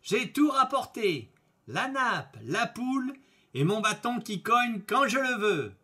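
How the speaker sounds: high, ringing triumphant announcement; joyful exclamation. A narrator's reading. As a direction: proclaim it happily, each item held out high. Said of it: "Il s'exclama joyeusement".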